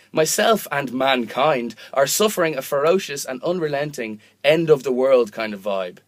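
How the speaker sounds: emphatic, mock-earnest open letter; performed letter reading. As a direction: read it out as a grand plea. Reads out a comic letter.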